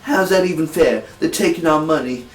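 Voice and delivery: put-on amateur take on a Brooklyn movie accent. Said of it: "he does this Brooklyn accent".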